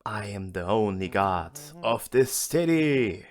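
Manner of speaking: loud, slow proclamation; hammy rooftop proclamation; mock scream. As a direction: declare it to the whole city, building to the end. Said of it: "stand on top of it and scream".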